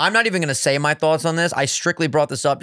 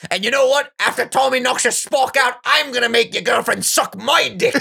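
quoting John Fury; 7 semitones higher than usual. Retold or imitated. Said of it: "he goes".

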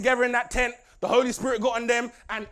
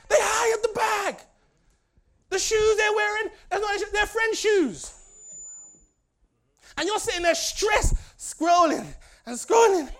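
high, loud preacher shout; full-force preaching; 10 semitones higher, 3 dB louder than usual. Acted. Preacher scolds the Instagram generation, shouting.

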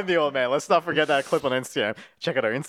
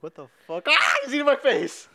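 panicked shout; 13 semitones higher, 4 dB louder than usual. Pretend. Voicing startled property owner.

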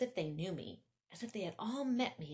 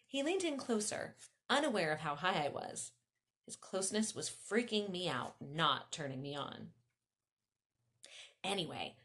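usual speaking voice

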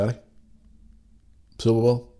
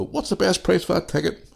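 mock customer; 8 semitones higher than usual. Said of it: "People come into your shop and go".

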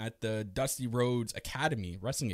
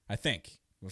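usual speaking voice

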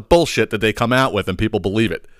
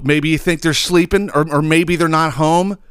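ordinary talk

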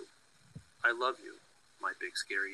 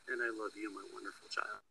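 softer, tender closing words; 2 semitones lower, 8 dB quieter than usual. Acted. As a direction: hush it lovingly to a child. Mother's tender closing line.

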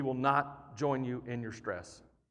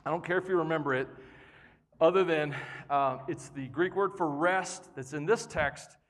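ordinary talk

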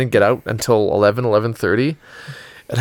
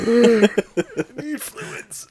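parched croak; 10 semitones higher, 3 dB quieter than usual. Retold or imitated. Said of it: "you're not like".

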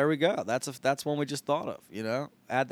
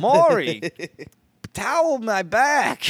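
high, loud, slow demand; Maury Ballstein voice; 8 semitones higher, 9 dB louder, slower than usual. Pretend. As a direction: bark it like a sleazy agent. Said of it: "Maury Ballstein".

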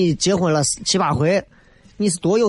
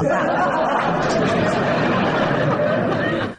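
breathy high giggle; laughing; 14 semitones higher than usual. Real, not acted. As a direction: burst out laughing. Laughing at own joke.

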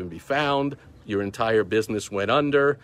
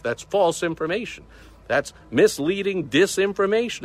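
high, mocking censor voice; mock the censors in a high pitch; 9 semitones higher, 4 dB louder than usual. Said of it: "Facebook's gonna say".

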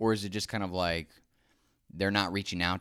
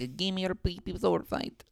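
silly high voice; 11 semitones higher than usual. Acted.

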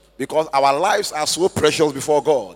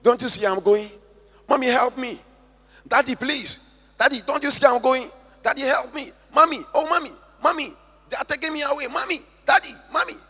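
put-on high, pleading child's cry for her parents; imitating a frightened little girl, much higher; dying girl screaming; 8 semitones higher than usual. Dying girl begging her parents in a high child's cry. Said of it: "she was screaming".

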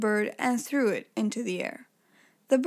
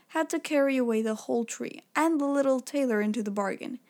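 ordinary delivery

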